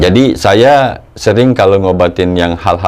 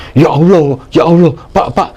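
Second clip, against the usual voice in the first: higher-pitched imitation of a scared girl; retold frightened cry; 7 semitones higher than usual. Daughter telling the healer she sees a huge tiger, mock frightened. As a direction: act out her alarm, pitch raised. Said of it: "Pak, takut".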